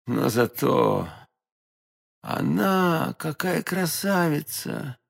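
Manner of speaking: slow, soft, dreamy admiration; faint murmur. A narrator's reading. Fainting d'Artagnan recalling the lady, murmuring. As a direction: drift into weak, slow admiration. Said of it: "прошептал Д'Артаньян".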